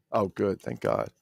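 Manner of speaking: ordinary delivery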